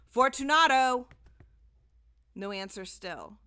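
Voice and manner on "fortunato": much louder, higher shout; calling again. A narrator's reading. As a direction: call into the silence once more. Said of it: "I called again".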